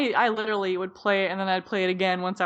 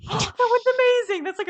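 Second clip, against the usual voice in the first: excited gasp; 13 semitones higher, 5 dB louder than usual. Real, not acted. Defends her favourite ride.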